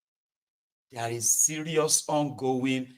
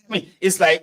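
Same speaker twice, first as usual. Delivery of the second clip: ordinary talk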